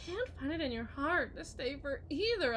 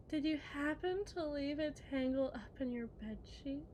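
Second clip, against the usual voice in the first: ordinary delivery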